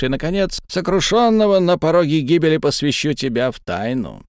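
high, menacing otherworldly voice; menacing creature voice. Acted. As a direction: threaten like a tormenting spirit. Creature threatens Chen.

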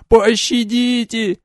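very high, slow mad wail; a shriek of pure terror; frantic shouting hostage taker. A narrator's reading. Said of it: "безумный вопль".

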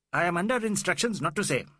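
high, quick official refusal; shrill police inspector. A narrator's reading. Inspector under instructions answering Fielding, high and shrill. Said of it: "said in shrill tones".

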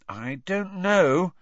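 slow, hesitant and high. A narrator's reading. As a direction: draw the words out doubtfully. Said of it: "Hermione said slowly".

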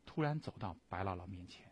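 plain reading voice

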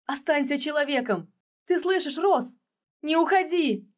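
high anguished cry; pained cry. A narrator's reading. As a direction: cry out in pain and longing. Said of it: "наполненный болью крик".

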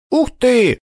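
little brother's high, thrilled gasp; delighted wow. Acted. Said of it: "оценил его мастерство ладошка".

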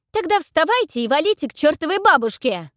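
high, very loud furious yell; girl yelling at the brute to go to hell. A narrator's reading. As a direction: scream it at him furiously. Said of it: "заорала я".

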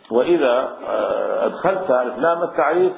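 usual speaking voice

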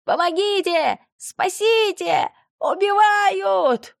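very high, slow, hoarse shrieking; wheezing scream for help. A narrator's reading. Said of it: "Хрипит".

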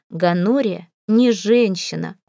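plain reading voice

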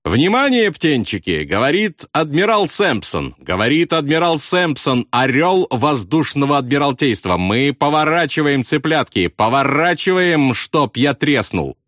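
high, grandiose mock-admiral announcement; mock announcement shout. A narrator's reading. Pilot hails crew as admiral over intercom, mock announcement. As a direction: stretch it out pompously, rolling into the command. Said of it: "крикнул в переговорное устройство".

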